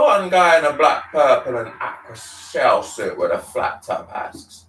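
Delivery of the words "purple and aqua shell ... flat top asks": plain reading voice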